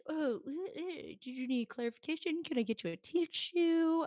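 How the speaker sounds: high, mocking fake politeness; snarky offer of help; sarcastic retort. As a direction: sneer it sweetly. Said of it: "Yeah, like".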